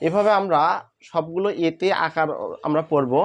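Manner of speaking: ordinary delivery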